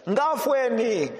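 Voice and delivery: put-on slow Bemba-accented plea for help; "Ngafweni" drawn out slowly in a Bemba plea. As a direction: cry "Ngafweni" like a Zambian woman in Bemba accent. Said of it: "speaking this in Bemba accent".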